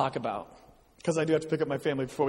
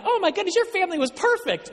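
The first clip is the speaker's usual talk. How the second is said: high, gushing mock fan voice; gushing admirer; 13 semitones higher than usual. Pastor mimicking people who admire his family, high and gushing. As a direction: gush like an impressed churchgoer.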